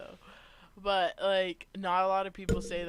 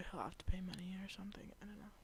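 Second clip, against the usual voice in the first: fast hushed whisper; 11 dB quieter, faster than usual. Real, not acted. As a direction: whisper it quickly so nobody hears. Whispering while partner is away.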